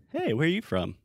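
high, friendly mock greeting question; curious stranger. Teacher voicing an American meeting someone new, curious. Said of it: "do you think they say, like".

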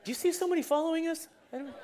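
high, quick movie-quote voice; lightly acted film dialogue; movie character. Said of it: "think of is The Princess Bride".

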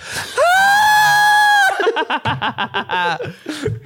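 high shrieking burst of laughter. Real, not acted. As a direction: howl with laughter. Cracks up at the bit.

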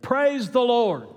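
high, slow, loud cry of praise; full-voiced praise shout. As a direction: shout it out as loud as you can. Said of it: "as loud as she could say it".

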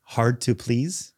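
hard to please, said slowly; slow English. Shown as a demonstration. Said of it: "if I say it in English".

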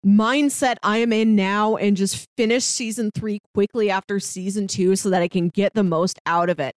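ordinary delivery

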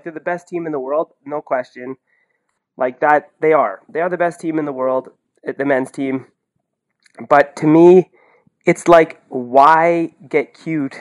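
normal talking voice

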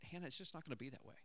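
soft, fast, understated refusal; calm, quiet firmness. Retold or imitated. Dad putting his foot down with daughter, calm and soft. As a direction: put your foot down without raising your voice. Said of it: "I'm just as calm as I could".

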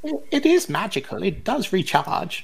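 high-pitched character sales pitch; haggling seller. Acted. Seller defending the magical box to a buyer, high voice. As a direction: pipe up high to sell the goods.

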